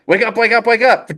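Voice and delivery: high, insistent "wake up" on repeat; urgent waking call. Acted. Zhen trying to wake sleeping Po, urgent high call. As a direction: rouse a heavy sleeper, high-pitched and urgent.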